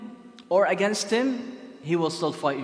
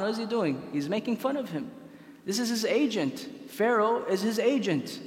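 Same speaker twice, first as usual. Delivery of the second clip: ordinary delivery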